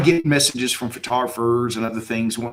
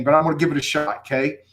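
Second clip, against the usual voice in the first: ordinary delivery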